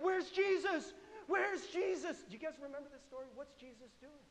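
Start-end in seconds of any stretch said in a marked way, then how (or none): 0.0-2.2 high, loud mock-frantic calling; panicked cry. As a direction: act out terrified disciples crying for help. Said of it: "They're screaming, and they're crying".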